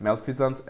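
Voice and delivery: Malfeasance as "mel-fee-zuns", the z sound clear; teacher repeats Malfeasance: mel-fee-zuns; correct pronunciation. Shown as a demonstration. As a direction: model pronunciation of Malfeasance for students. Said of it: "ऐसे pronounce करते हैं".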